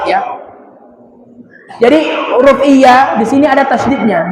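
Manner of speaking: ordinary delivery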